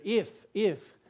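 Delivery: each "If" set apart and emphasized; stress on If. Shown as a demonstration. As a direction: repeat "If" as its own emphatic word. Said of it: "I emphasize the word "if".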